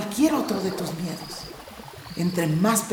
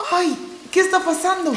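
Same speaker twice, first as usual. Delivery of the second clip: higher, alarmed yelp; startled panic; 9 semitones higher, 3 dB louder than usual. Acted. Core sinking in mud, frightened and high. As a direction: cry out as the ground gives way.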